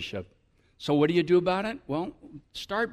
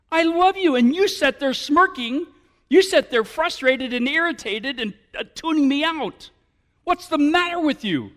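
louder, higher pleading at the deaf listener; exasperated lover; 7 semitones higher, 6 dB louder than usual. Acted. Pastor acting a spurned lover, exasperated and loud. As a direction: plead loudly, hurt they tune you out.

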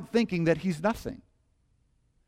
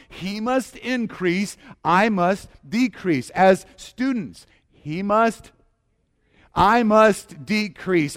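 slow, rising, louder chant with the people; call and response; 3 semitones higher, 4 dB louder, slower than usual. Real, not acted. Pastor calling each group in the church to repeat, slow and strong. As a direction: lead each group through the motto. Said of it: "Say it with me".

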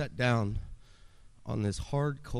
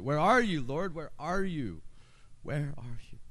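desperate crying plea; 5 semitones higher than usual. Retold or imitated. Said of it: "as I'm crying".

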